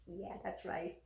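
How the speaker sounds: soft, low, amused murmur; a quiet knowing smirk; calm smiling aside. Retold or imitated. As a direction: smile and let him rave.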